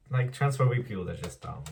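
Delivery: ordinary talk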